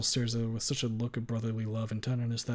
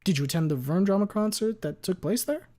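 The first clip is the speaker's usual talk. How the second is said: higher, louder lawyer question; 9 semitones higher, 5 dB louder than usual. Acted. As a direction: ask it like a sharp attorney.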